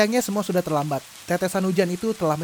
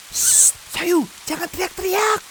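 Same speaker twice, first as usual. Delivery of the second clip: high, hushed scolding; hushing; 11 semitones higher than usual. Acted. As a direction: shush her quickly. Hushing the shouting girl.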